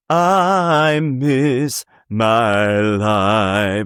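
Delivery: very slow, stretched-out line; plain slow read; drawn out. Shown as a demonstration. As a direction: draw every word out slowly. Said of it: "instead of".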